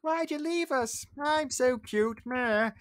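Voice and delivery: put-on squeaky high little-kid voice, slow on so cute; Chip the teacup. Retelling the story, voicing little Chip begging Belle. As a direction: whine like a tiny cute teacup child. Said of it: "asking her questions like".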